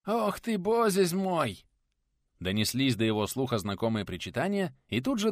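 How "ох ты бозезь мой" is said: put-on high, slow lisping old woman's lament; old woman wailing. Old woman fussing over the fallen teacher, high lisping wail.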